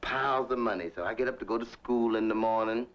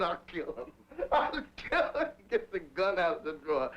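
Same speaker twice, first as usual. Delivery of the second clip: laughing mock threat; 5 semitones higher than usual. Mother's threat, retold laughing.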